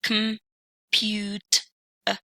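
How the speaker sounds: computer split into syllables, "Compute, uh", with gaps between. Shown as a demonstration. Teacher showing the three syllables of computer, said in separated pieces. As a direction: sound out "Compute, uh" piece by piece, the end a lone schwa. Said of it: "three syllables".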